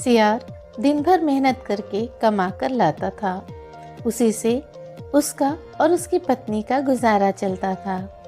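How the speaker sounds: usual speaking voice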